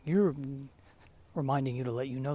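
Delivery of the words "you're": high, loud robotic alarm voice. Retold or imitated. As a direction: chirp it like a house alarm robot. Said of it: "a robotic voice saying".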